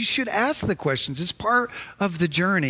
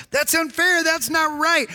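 high-pitched complaint; indignant protest; 9 semitones higher than usual. Acted. Preacher voicing a frustrated believer questioning God, in high indignant protest.

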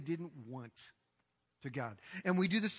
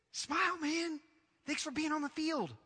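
higher, softer, mock-gentle coach; mock sweet coach; 6 semitones higher, 6 dB quieter than usual. Mock-gentle coach thanking a slacking player, softer and higher. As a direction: coo it like an overly nice coach. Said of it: "You think the coach would be".

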